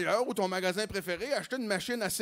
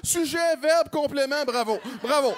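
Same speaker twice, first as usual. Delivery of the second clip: higher and louder teacherly cheer; mock praise; 6 semitones higher, 6 dB louder than usual. Comic praising the woman's French, higher and louder. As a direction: applaud the grammar loudly.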